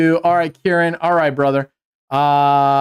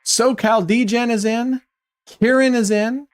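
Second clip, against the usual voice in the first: announcing entrants; 5 semitones higher than usual. Real, not acted. Calling out entrants with rising tune.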